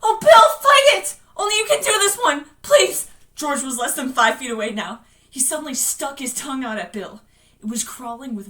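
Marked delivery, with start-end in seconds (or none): 0.0-3.1 high, loud frantic begging; screaming plea. Acted. Beverly pleading with Bill to fight, screaming. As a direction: scream and beg him desperately. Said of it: "Beverly was screaming".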